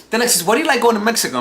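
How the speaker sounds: high, quick imitation of a questioner; imitated nosy questioner. Someone quizzing him about Mexico, high mock-curious voice.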